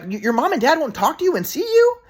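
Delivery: put-on high, shocked old grandma. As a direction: play the stunned grandmother. Grandma's shocked reply, voiced.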